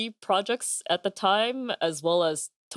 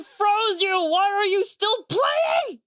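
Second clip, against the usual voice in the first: shouting; 13 semitones higher, slower than usual. Real, not acted. Yelling at someone off stream.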